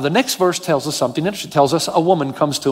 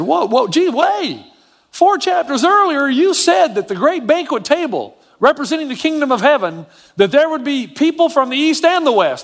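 protesting disciple; 7 semitones higher than usual. Pretend. Disciple objecting to Jesus.

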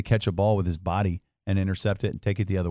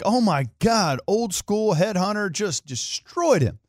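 higher, slow stunned gasp at the hit; shocked reaction; 9 semitones higher, slower than usual. Retold or imitated. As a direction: retell it like you just saw a car crash. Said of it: "it looked like".

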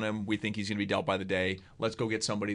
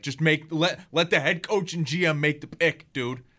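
restaurants' mock call-out to the owner, louder and higher; 5 semitones higher, 5 dB louder than usual. Restaurants calling out the team owner over the pick, calling out. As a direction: raise pitch and volume like a public plea.

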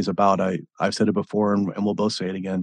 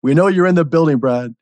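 louder and higher, hyping up the crowd; hype announcer; 8 semitones higher, 8 dB louder than usual. Acted. Host playing the stage announcer calling Brad out, loud and higher. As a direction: boom it out like an arena announcer.

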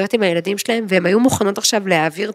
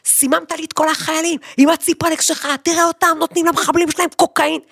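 screaming; 8 semitones higher than usual. Retold or imitated. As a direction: scold the army psychologist at the top of your lungs. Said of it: "צורחת, יש לנו חבר פסיכולוג".

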